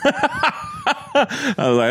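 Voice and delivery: laughing. Real, not acted. Laughing while telling.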